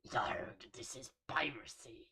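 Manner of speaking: hushed, breathy pirate whisper of the same line. Acted. Retaking the pirate line as a secret whisper. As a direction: lean in and whisper the pirate threat.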